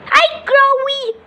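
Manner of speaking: high, slow, squeaky little voice; sing-song baby bird hello; young bird greeting. Pretend. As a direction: greet like a tiny chick, drawn out. Second young bird greets the crow.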